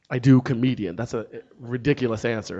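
normal talking voice

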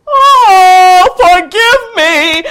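high, loud pleading wail; terrified magistrate. Acted. Magistrate begging the dragon's forgiveness, terrified. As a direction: beg the dragon for mercy. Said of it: "the magistrate looked and said".

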